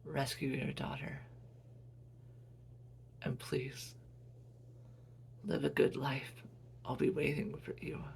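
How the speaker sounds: hushed, partly whispered goodbye from a dying mother; gentle whispered last words; soft dying farewell. Acted. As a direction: let her fade as she speaks.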